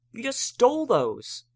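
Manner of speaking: high, loud, slow accusation; Spike accusing. A narrator's reading. Spike accuses Twilight of stealing, high and slow. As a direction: spell out each word in outrage.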